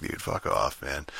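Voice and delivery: muttered fuck-off, barely voiced; dismissive whisper. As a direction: wave them off under your breath. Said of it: "I was just fucking like".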